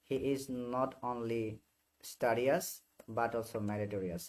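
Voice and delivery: each word spaced out slowly; slow example read. Shown as a demonstration. Teacher dictating an English example slowly.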